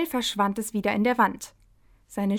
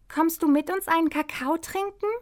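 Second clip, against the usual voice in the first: higher, hopeful bunny voice; little hare voice; 7 semitones higher than usual. A narrator's reading. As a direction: ask sweetly like a hare. Hopsi fragt Karina. (hohe, hoffnungsvolle Häsinnenstimme)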